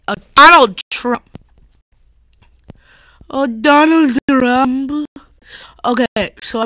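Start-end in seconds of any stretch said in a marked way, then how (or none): 0.1-5.1 loud, high, hammed-up weird accent as a kid playing Trump's wife; weird mock accent. Kid mimicking a camp kid's weird accent, loud and high. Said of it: "talked in this weird accent".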